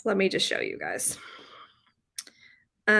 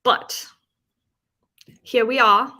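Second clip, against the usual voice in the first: mock British accent, louder; 3 semitones higher, 7 dB louder than usual. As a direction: lapse into an amateurish British accent. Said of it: "I'm doing my British accent again".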